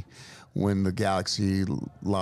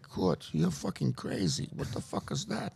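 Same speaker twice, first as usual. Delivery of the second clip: imitating his shocked dad; 2 semitones higher, 5 dB quieter than usual. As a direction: play the stunned father who can't believe what he saw. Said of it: "And he's like".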